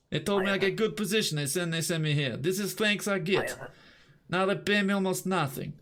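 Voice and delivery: put-on complaining guard, Eastern European accent. Guard griping to inspector about pay, mock Slavic accent.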